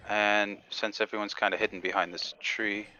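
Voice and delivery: usual speaking voice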